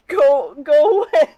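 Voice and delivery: high, loud, crying; tearful plea. Acted. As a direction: beg him to go away. Acted scared character line.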